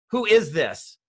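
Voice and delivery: emphatic, put-on bafflement; puzzled demand. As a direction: play someone baffled by an unknown texter. Acted puzzled reply to unknown texter.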